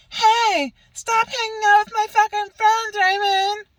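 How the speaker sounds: high, loud squeaky mock of the ex; mocking girly voice. Mocking the ex on her morning call, high squeaky voice. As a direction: screech it like a petty caricature of her.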